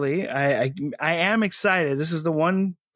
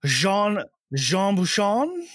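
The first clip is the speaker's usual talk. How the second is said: slow mock posh "Jean Buchan", drawn out; puffed cheeks mocking; 5 semitones higher, much slower than usual. Shown as a demonstration. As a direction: say "Jean Buchan" like a pompous Brit. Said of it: "you should do it in a posh accent".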